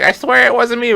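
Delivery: put-on high, loud old granny voice; old lady voice. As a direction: play a protesting granny. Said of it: "she's like".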